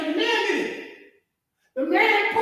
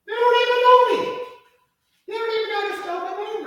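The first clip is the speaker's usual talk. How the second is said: loud lament; 6 semitones higher, 3 dB louder, faster than usual. Acted. Voicing the Lord's complaint.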